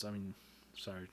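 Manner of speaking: normal talking voice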